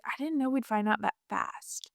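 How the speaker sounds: a stunned hush from the husband; whispered husband. Retold or imitated. Husband realizing they found out so soon, whispering. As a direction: breathe it out in disbelief.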